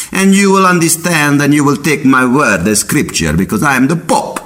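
high, lofty mock Pope lecturing; pompous Pope. Pope scolding Galileo about the flat earth, high pompous mock voice. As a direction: proclaim it like an offended Pope. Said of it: "and the Pope's like".

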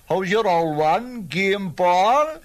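sly northside Cork dig, said sideways; northside Cork irony. Retold or imitated. Northside Corkman needling a neighbour about his mother, ironic Cork accent. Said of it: "spoken with irony and out of the corner of the mouth".

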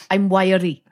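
light mock Welsh accent; a hint of Welsh, imitating. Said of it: "Gene Hackman doing a Welsh accent".

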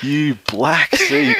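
slow, drawn-out mock curse; mock Wally curse. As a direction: stretch out the insult like Wally seething. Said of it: "Wally's just like".

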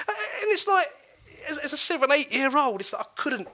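high, cracking voice close to tears; voice breaking. Real, not acted. Remembering seeing his head teacher beat a girl, near tears. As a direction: fight back tears.

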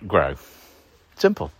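normal talking voice

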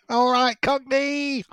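high, strained northern accent; put-on northern accent. Shown as a demonstration. Host showing off accents when asked, high northern accent. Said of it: "what's your favourite accent to do".

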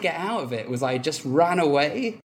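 ordinary delivery

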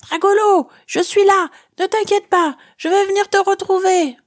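yelling with all her might. A narrator's reading. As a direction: shout to reach him behind the rubble. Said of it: "Je hurle de toutes mes forces".

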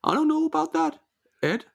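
high-pitched mock Fargo accent. Fargo guest disputing the host's remark, high Fargo accent. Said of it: "Oh, I'm from Fargo".

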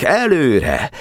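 high rallying cry; shouted order. A narrator's reading. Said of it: "kiáltották". (csatakiáltás, magas hangon)

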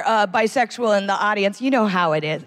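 usual speaking voice

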